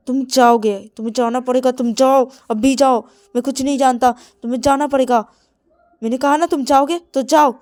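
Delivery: loud, high commanding insistence; angry order. Acted. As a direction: order him out, no arguing. Ordering him to go.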